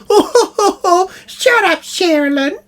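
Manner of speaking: high, loud laughing jeer; mocking laugh. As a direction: laugh it off at your wife. [name] chimes in laughing.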